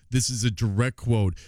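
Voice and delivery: ordinary talk, nothing marked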